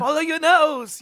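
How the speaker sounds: British explorer voice, high and loud; hammed-up Toucan Sam impression; lockjaw accent. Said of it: "he sounds like a British explorer".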